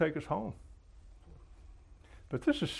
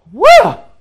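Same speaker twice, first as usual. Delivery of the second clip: loud, much higher "Well?" shouted at him; demanding wife; 23 dB louder than usual. Retold or imitated. Wife demanding her husband's verdict on the casserole, loud and high. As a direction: insist on an answer, loud and high.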